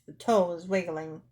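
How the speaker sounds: plain reading voice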